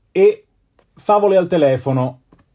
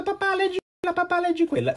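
put-on much higher, softer child's plea; imitating a child; 5 dB quieter, faster than usual. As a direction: beg dad like a small kid. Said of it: "mi ha detto". (imitando un bambino che supplica papà, voce molto acuta e più piana)

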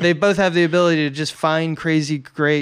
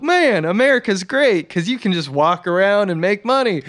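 higher, swooping mock wonder; excited; 4 semitones higher than usual. Podcaster voicing the guys thrilled to make money, excited. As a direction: gush like an easily impressed simpleton. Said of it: "excited about".

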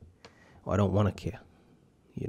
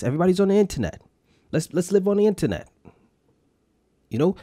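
higher and louder, fired up; hyped rant; 7 semitones higher, 5 dB louder than usual. Real, not acted. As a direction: rant with rising energy. Hyped rant about podcasting.

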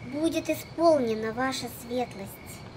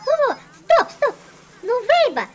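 much higher, louder stop stop; excited interruption; 7 semitones higher, 9 dB louder than usual. Acted. Caller stopping the agent at the right resort, excited. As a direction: cut them off, thrilled.

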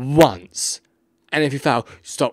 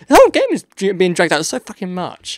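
much higher, louder fed-up rant; agitated outburst; 7 semitones higher, 6 dB louder than usual. Real, not acted. Player venting at the dragged-out game, agitated outburst. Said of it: "I apologize for getting so, um, agitated".

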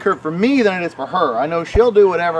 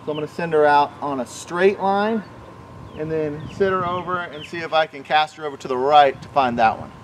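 normal talking voice

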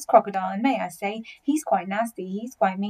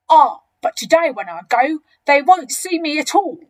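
loud, raised boastful voice; big croc sure of his scheme; enormous crocodile, smug; 5 semitones higher, 9 dB louder than usual. Acted.

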